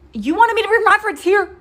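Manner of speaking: high, loud, incredulous "you wanted me to bring my friends here?"; disgusted disbelief. Retold or imitated. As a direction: sneer it in disbelief. Disgusted quote to her date.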